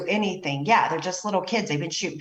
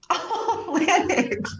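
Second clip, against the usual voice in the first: higher, with a laugh through it; laughing disbelief; 9 semitones higher, much slower than usual. Real, not acted. Host laughing at Lennox's close call, disbelieving laugh. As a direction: laugh it out, still stunned.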